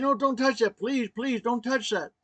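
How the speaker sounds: high, pressing begging; retold urgent plea. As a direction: beg her to stop, urgently. Begging wife not to touch IV.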